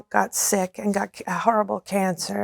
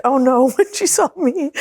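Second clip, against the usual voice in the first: mock dismay laughing; 4 semitones higher, 4 dB louder than usual. Voicing the woman's dismay.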